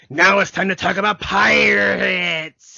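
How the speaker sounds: loud, slow announcer voice; hammed-up announcement. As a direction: boom it out like a show host. Announcing pirate segment.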